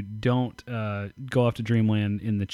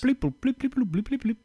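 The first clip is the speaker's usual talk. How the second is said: mock beeping; 12 semitones higher than usual. Imitating counter beeps.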